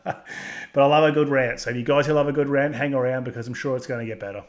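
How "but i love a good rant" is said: slow, laughing through the words. Real, not acted. Ranter laughing at his own rant to viewers, amused. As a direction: chuckle it out, amused at himself. Laughing while admitting ranting.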